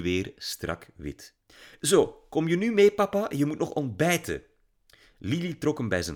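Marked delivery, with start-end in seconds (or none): 1.8-4.4 put-on high, loud girl urging; girl urging her father to leave; eager girl. A narrator's reading. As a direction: tug him along like an eager girl.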